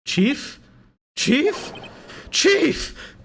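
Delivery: high questioning call building to a cry; rising alarm. Acted. Assistant finding the chief not answering, alarm rising to a cry.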